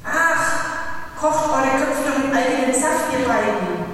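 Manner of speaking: irritated outburst, dropping at the end; exasperated. A narrator's reading. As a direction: dismiss them with loud impatience. Exasperated outburst at two adults.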